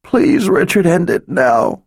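high broken plea through tears; sobbing. A narrator's reading. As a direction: beg while sobbing. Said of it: "she sobbed".

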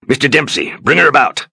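high, loud command to the helmsman; shouted order. Acted. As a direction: yell across the deck. Captain yells at helmsman.

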